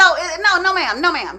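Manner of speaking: plain reading voice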